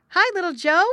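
high, loud call across the water; bright hailing yell; shouted call. Acted. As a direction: shout out to a friend in the pool. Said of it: "shouted Jerry".

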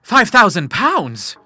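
high, loud, slow amazement; astonished gasp. A narrator's reading. Son gasping at his mother's cheque, astonished. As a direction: stretch out each word in astonishment. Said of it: "he gasped".

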